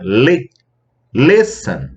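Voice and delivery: Li alone, then Listen stressed LIS-ten; stress on first syllable. Shown as a demonstration. Teacher showing the stressed syllable, Listen stressed on Li. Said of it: "a sílaba tônica é a primeira".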